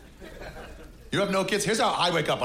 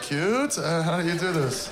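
fake enthusiasm; 6 semitones higher, slower than usual. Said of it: "I'm always like".

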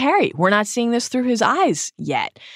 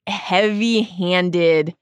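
normal talking voice

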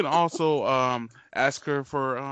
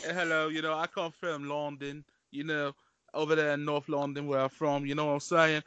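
put-on North London accent; casual caricatured London accent; British flair; 4 dB quieter than usual. Shown as a demonstration. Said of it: "some British flair as well".

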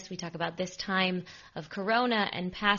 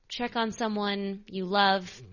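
slow, leaning on "on" in "check on someone"; a slow, sincere appeal; slow earnest plea; slower than usual. Real, not acted. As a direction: ask it gently and deliberately, stretching "check on". Pleading with listeners to check in.

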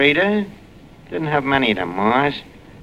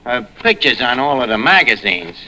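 ordinary talk, nothing marked

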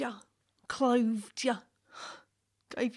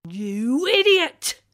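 angry shouting; 2 semitones higher, 10 dB louder than usual. Acted. Woman scolding the boy.

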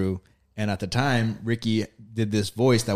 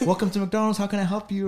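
imitating drive-through worker; 10 semitones higher than usual. Worker greeting the car at drive-through, imitated high. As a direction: pitch it up like an order-taker. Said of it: "She goes".

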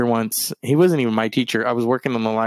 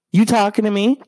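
high, louder mock indignant woman; 9 semitones higher, 4 dB louder than usual. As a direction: squeal it like she's offended. Rude woman voiced.

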